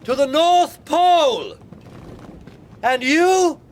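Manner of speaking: loud insistent hail; shouting. Acted. Captain urges the stranger aboard, loud high shout. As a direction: shout the order high across the ice.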